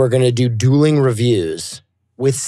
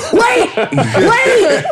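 surprised. Retold or imitated. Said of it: "And I'm like".

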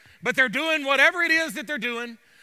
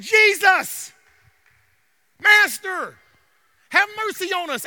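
loud, high plea to God; raised preacher's cry, pausing between calls; 5 semitones higher, 6 dB louder than usual. Acted. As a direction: cry out for mercy from afar. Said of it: "open their mouths and cry out to God".

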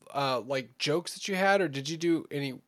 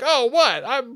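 high, loud fake surprise; mock innocent surprise; 9 semitones higher, 8 dB louder, slower than usual. Comedian caught out by crowd, mock surprise. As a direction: play dumb, overplayed.

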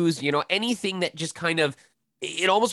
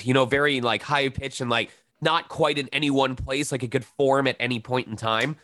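normal talking voice

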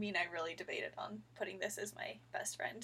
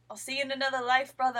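higher, louder Scottish impression; Scottish accent; 3 semitones higher, 9 dB louder, faster than usual. Fan quoting Desmond from Lost, Scottish accent. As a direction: call it out in a playful Scottish accent. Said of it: "ending to that Scottish accent".

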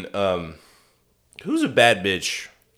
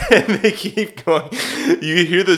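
ordinary delivery

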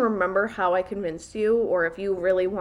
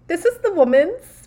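higher, louder, teasing impression; imitating her friend; 8 semitones higher, 6 dB louder than usual. Teasing her friend about the frat bathroom, mock flustered. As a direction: mimic your flustered friend. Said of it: "I could just imagine you being like".

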